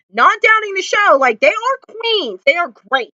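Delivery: high, excited gushing; gushing praise. Real, not acted. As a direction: rave about them with delight.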